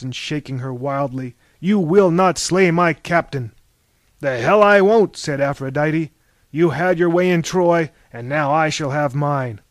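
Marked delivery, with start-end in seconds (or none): 1.6-3.5 loud, raised, forceful forbidding; commanding cry. A narrator's reading. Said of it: "cried Athena". 4.2-5.2 high, loud, angry comeback; defiant retort. A narrator's reading. Goddesses in an acted quarrel. 6.5-9.6 loud, raised quarrelling voice; defiant retort. A narrator's reading. Goddesses in an acted quarrel.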